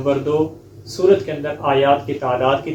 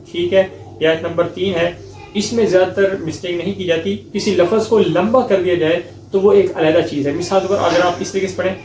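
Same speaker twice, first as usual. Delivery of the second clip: ordinary delivery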